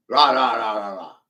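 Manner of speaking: sing-song dismissive la la; la la la trailing away; mocking la la la. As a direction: wave off the rest with a taunting la la. Dismissive filler for more laws.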